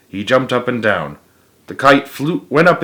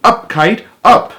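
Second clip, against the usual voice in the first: shout; 10 semitones higher, 5 dB louder than usual. Acted.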